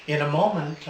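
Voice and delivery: usual speaking voice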